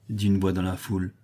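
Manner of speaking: ordinary delivery, nothing marked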